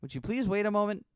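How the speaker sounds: loud exasperated plea; fending off a grabby customer. Acted. Clerk pushes back, flustered.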